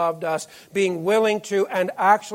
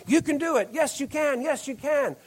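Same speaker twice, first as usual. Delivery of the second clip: mock cheerleader cheer; 6 semitones higher, 3 dB quieter than usual. Preacher retelling cheerleaders at a game, mock cheer. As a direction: imitate cheerleaders egging on a losing team.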